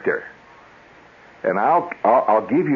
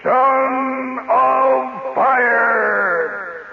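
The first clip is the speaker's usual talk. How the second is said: huge echoing high holler, words held very long; hollered character name; 4 dB louder, much slower than usual. Retold or imitated. Show opener hollers the hero's name, echoing holler. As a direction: holler it across a cave, drawn right out. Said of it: "He hollered the name of the character".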